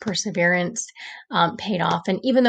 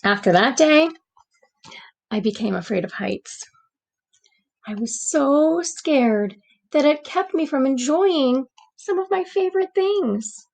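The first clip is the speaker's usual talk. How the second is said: gentle read-aloud storytelling voice; story narration; 4 semitones higher than usual. A narrator's reading. Teacher reading a storybook to the class, gentle narration. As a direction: read it like a bedtime picture book.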